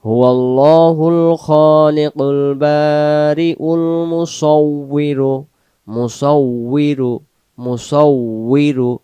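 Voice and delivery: slow melodic tajweed chant; Quran recitation. Shown as a demonstration. Teacher chanting the verse for students, slow and melodic. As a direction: draw out each vowel in recitation.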